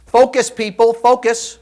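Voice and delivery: loud, high call to a distracted group; rallying shout. A boss calling the team to focus, loud. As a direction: snap the room to attention. Visual person rallying a room.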